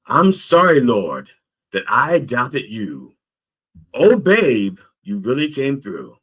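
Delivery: high, loud opening cries; dramatic grateful prayer; exclaimed apology to God. Acted. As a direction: burst out apologizing, then ease off. Acting the man's prayer of apology.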